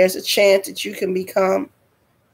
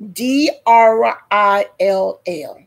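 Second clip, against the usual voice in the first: D R I L L spelled slowly, letter by letter; emphatic spelling; slower than usual. Real, not acted. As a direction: stretch out each letter of D R I L L.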